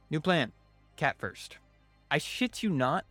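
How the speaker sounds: ordinary delivery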